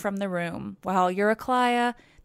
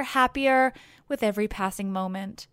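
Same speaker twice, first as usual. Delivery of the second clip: ordinary delivery, nothing marked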